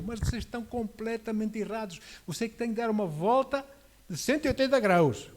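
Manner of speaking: fiery preaching. Acted. Preacher rebuking his generation, building in force. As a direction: drive it home to the congregation.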